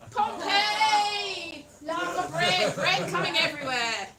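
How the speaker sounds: high, loud comic exclamation; big hammed-up yell; mock dramatic shout. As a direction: shout it like an eruption. Mock announcement in lava joke.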